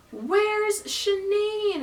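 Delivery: very high, very slow holler; imitated screaming. Guard repeating himself across a big stadium, mock screaming. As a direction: drag out each word like a long-distance yell. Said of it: "he was really screaming my name".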